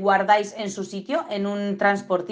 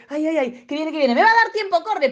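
mock panic rush; 6 semitones higher than usual.

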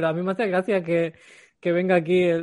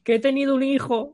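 whiny excuses; 8 semitones higher, 3 dB louder than usual. Retold or imitated. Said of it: "el resto".

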